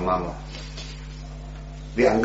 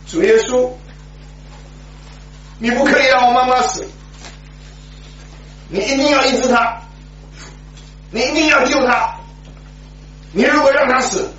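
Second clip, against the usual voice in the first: desperate prayer; 14 semitones higher than usual. Retold or imitated. Boy kneeling by his bed, begging Jesus through tears. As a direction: beg God through tears.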